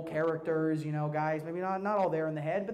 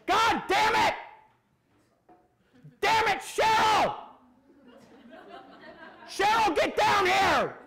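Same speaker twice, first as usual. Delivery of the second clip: much higher and louder furious yell; angry dad shout; 14 semitones higher, 6 dB louder than usual. Retold or imitated. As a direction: bellow at the mom like an enraged father. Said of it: "slamming his fist on the counter and going".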